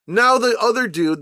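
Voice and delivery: ordinary talk, nothing marked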